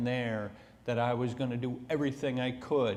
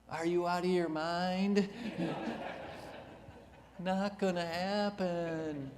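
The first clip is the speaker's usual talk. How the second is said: incredulous; 5 semitones higher, 3 dB quieter than usual. Real, not acted. Mocking the idea of moving home.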